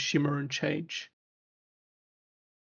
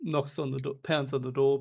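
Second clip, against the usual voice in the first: ordinary talk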